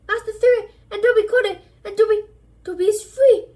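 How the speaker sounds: high, loud elf voice, exultant; Dobby crowing that he is free; triumphant elf. Acted. Dobby triumphantly declares freedom.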